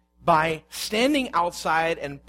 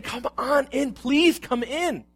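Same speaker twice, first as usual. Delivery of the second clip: higher, slow and coaxing; pleading father; 6 semitones higher, slower than usual. Acted. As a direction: gently beg the son to come inside. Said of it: "The father is pleading with him".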